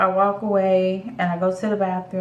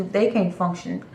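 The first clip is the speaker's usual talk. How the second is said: normal talking voice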